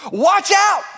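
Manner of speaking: very high, loud shout; shouted warning; exclamation shout. Acted. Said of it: "with an exclamation point".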